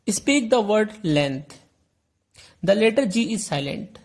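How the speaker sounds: slow, deliberate reading of the exercise; slow textbook read. Shown as a demonstration. Teacher reading the exercise aloud to students, slow and deliberate. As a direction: read each word out slowly for the class.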